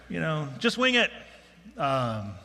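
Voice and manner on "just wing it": higher-pitched retold call-out; imitated yell. Preacher retelling advice shouted during his notes crisis, mild imitated yell. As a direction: mildly mimic someone calling from the audience. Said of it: "yells out".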